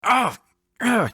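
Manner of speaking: sharp high cries of pain. A narrator's reading. Injured father shifting in bed, sharp pained cries. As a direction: yelp as a pain tears through the hip. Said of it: "a tearing pain through my left hip".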